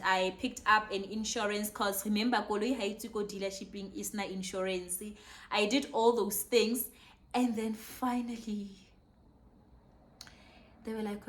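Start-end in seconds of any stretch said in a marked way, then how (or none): none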